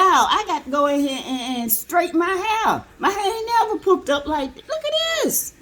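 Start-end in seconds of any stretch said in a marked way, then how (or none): 4.7-5.5 much higher, delighted squeal; thrilled high-pitched gush; amazed squeal. Real, not acted. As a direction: squeal in disbelief at the hair. Amazed at her hair.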